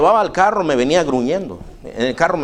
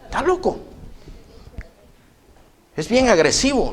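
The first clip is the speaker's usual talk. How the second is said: higher, insistent complaint; fed-up man voiced higher; 10 semitones higher than usual. Visitor insists the dog is aggressive, pitched up. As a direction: play him pitched up and insistent.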